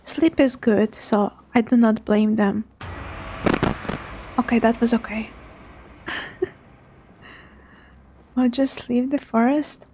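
ordinary delivery

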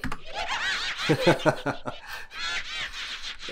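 high, breathy evil cackle; evil flower cackle. Acted.

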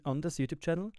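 usual speaking voice

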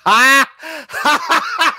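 loud high yelp of shock; sudden shocked yell; sharp shout. Real, not acted. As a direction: burst out with a startled cry. Streamer reacts to debate clip.

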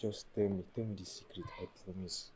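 low, soft confidential plea; hushed coaxing. Acted. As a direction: coax the secret out quietly. Coaxing friend for a secret.

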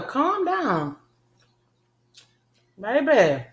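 ordinary talk, nothing marked

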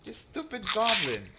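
high, angry yelling at goblin. Real, not acted. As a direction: shout at the thief. Scolding goblin thief.

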